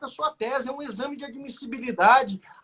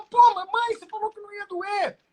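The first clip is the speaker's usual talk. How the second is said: put-on high, fast kid protesting to his mom; mock a little boy whining after a shot; whiny child complaint; 9 semitones higher, 4 dB louder than usual. (menino reclamando com a mãe, voz aguda e rápida)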